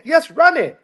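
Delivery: high Dominican-accented agreement, imitated; father's Dominican accent. Dominican dad agrees without hearing the difference, imitated high accent. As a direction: imitate a Dominican dad happily repeating RANNY. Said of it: "Yeah, it's the accent".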